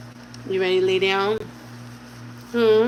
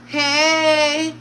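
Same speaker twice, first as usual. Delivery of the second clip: put-on copying baby; 6 semitones higher than usual. Mom echoes the baby's "hey.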